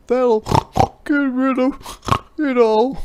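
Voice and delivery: high, loud snorty pig voice; snorting pig. A narrator's reading. Ping the pig gives advice, loud and snorty. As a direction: bark it out like a bossy pig. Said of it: "snorted Ping".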